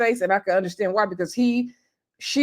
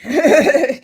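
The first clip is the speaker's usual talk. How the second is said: louder, higher burst of laughter; real laugh; 4 semitones higher, 6 dB louder than usual. Real, not acted. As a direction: crack up at your own remark. Laughs at own blunt verdict.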